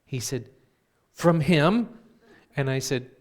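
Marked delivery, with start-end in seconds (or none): 1.1-2.0 loud, high, rising question; awed astonishment. Retold or imitated. Brother learns a letter came from Santa, loudly amazed. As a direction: burst out with amazed hope.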